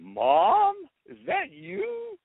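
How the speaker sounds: high-pitched, incredulous son; imitated disbelief. Son recognizes his mother on the phone, imitated disbelief. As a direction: squeak it out as mock shock.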